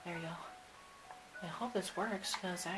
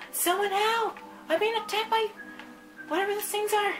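frightened shout; 12 semitones higher, 5 dB louder than usual. Acted. Attacked, calling for help.

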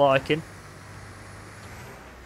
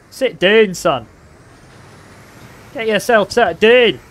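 loud, high mock-Scottish shouts; Scottish accent; 5 semitones higher, 5 dB louder, much slower than usual. Gamer to the lad he's ramming, put-on Scottish accent. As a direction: call it out like a rowdy Scot. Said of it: "I don't know why I've gone Scottish".

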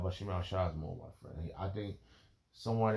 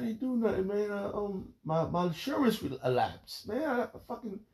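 friend's whiny excuse; 14 semitones higher, 4 dB louder than usual. Retold or imitated.

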